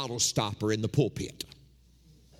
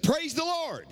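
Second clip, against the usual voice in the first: higher, lightly voiced church lady crying praise; a hint of a joyful woman's shout of praise; lady calling out; slower than usual. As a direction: call out praise like the bold lady in the pew. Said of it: "she said".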